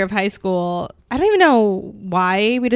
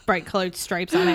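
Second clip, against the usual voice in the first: usual speaking voice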